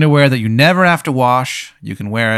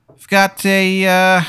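eager salesman voice; 9 semitones higher, slower than usual. Acted. GM voices salesman Thad Summers.

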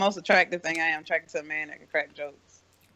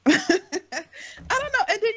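laughing; 12 semitones higher, much slower than usual. Real, not acted. Laughing at own story.

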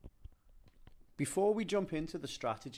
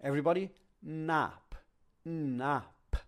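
teacher calling class; 3 semitones higher, slower than usual. Shown as a demonstration. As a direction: call out the word to students. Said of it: "you can say".

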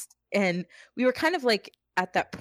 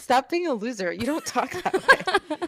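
higher-pitched mock telling-off, drawn out at the end; mock scolding; 5 semitones higher than usual. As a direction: playfully re-enact scolding a copycat. Re-enacts scolding sibling for accent.

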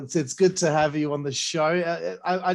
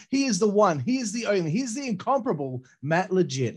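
announcer build-up; 5 semitones higher than usual. Acted. Host builds up the guest's introduction.

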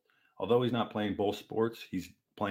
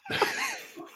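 short burst of laughter; amused laugh. Real, not acted. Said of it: "Uh, that's laughable".

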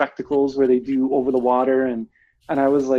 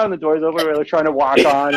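ordinary delivery

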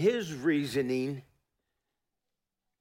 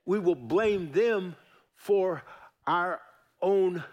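each word spaced and punched; slower than usual. Real, not acted. Preacher driving the point home word by word. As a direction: hammer every word slowly.

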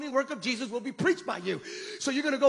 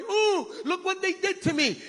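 higher, whiny mock complaint; mock whining victim; 8 semitones higher than usual. Preacher mocking a whining Jesus, high whine. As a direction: whimper like a self-pitying victim. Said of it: "Jesus did not go in there going".

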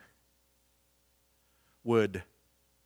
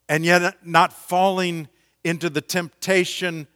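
full-force preaching; 6 semitones higher, 8 dB louder than usual. Acted. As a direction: build it like a pulpit crescendo.

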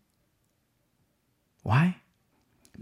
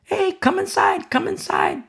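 mock call. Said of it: "shouting to the people".

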